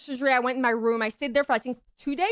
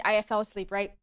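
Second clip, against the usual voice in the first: normal talking voice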